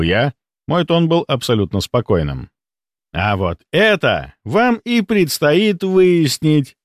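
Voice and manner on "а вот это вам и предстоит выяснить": slow, high, triumphant announcement; gloating villain, voice lifted. A narrator's reading. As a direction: savour the challenge, drawing out each word. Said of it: "торжествующе объявил Рудаков".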